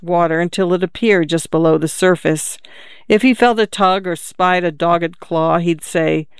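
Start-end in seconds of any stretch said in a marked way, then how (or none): none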